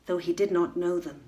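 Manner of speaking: plain reading voice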